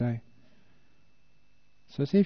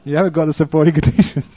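laughing through the words; 4 semitones higher, 11 dB louder, faster than usual. Real, not acted. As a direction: chuckle while saying it. Joking about being too old.

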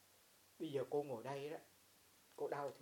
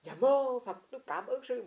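put-on sick old man; 9 semitones higher, 13 dB louder, slower than usual. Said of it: "ông nói".

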